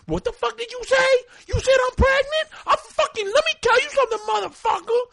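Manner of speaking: very high, loud mock tirade; hammed-up furious shriek in a put-on accent; outraged woman. Imitating Puerto Rican woman confronting the comic over pregnancy, outraged. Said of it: "what accent I'm doing".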